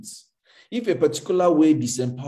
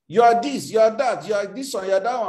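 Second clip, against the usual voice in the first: mock accuser; 8 semitones higher, 3 dB louder than usual. Mimicking people labelling him.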